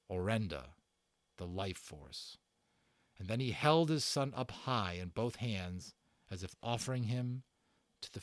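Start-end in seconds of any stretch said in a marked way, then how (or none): none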